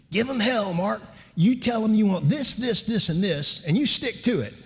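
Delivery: high-pitched, firm Southern lady; Southern mom. Retold or imitated. Southern mother coaching her son to negotiate, high and firm. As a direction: imitate a Mississippi mother urging her son on. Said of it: "good Southern lady from Mississippi".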